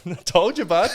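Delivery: high, loud, laughing I told you bud; captain's told-you-so. Retold or imitated. Retelling his captain's line after the split eye, laughing. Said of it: "my captain was like".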